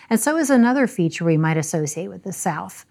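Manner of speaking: ordinary talk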